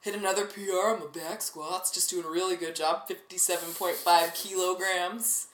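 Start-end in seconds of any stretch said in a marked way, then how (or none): none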